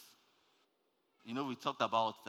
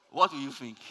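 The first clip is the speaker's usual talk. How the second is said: loud, animated question; excited question; 3 semitones higher, 8 dB louder than usual. Pretend. Preacher teasing the congregation about a turbulent plane, animated. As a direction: throw the question at them with glee.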